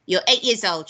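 loud scornful grandma retort; snorting scorn. A narrator's reading. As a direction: throw it back with a touch of a snort. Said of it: "she snorted".

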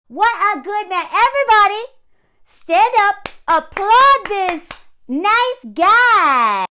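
rally the crowd in heavy sarcasm; sarcastic praise. Acted. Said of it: "sarcastic applause call".

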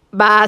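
loud sheep bleat; a long sheep baa. A narrator's reading. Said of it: "said the sheep".

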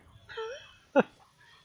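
short, high burst of laughter; amused laugh. Real, not acted. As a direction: let out a quick laugh. Reacting to an annoyance in game.

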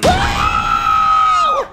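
high shriek of fright. Acted. Marvin startled by knife frog.

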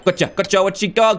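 usual speaking voice

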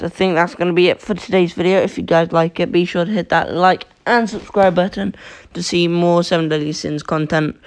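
ordinary delivery

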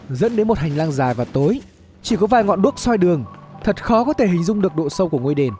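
usual speaking voice

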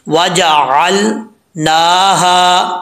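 वजअलनाहा slow with a clear ain and no glottal stop. Shown as a demonstration. Teacher showing the clear ain, slow and careful. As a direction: say वजअलनाहा slowly, ain distinct. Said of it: "आइन को clear करके पढ़ना है".

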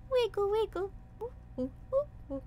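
high cutesy sing-song; playful sing-song. Pretend. Vtuber cooing while wiggling her ears. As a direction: chirp it like a toy.

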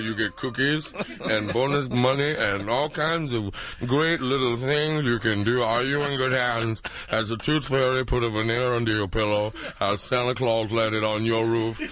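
deep reassuring ad voice, hammed up; Allstate guy voice. Comedian parodying the Allstate ad man reading smut. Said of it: "Here is the Allstate guy doing Penthouse Forum".

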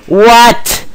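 high loud startled what. Real, not acted. Gamer reacts to an explosion, startled. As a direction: jump at the blast.

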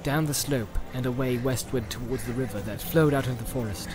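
plain reading voice

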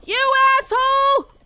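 shrill indignant scream; outraged shout. Real, not acted. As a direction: gasp then high furious yell. Opponent steals her egg.

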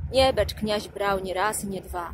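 ordinary delivery, nothing marked

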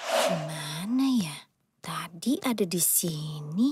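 whispered search to herself; unvoiced muttering while looking. Mother searches for phone.

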